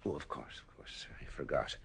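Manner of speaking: whispered, dry sarcasm; soft knowing whisper; sarcastic whisper. Acted. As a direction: pretend to remember, mockingly. Sarcastic reply to a lie.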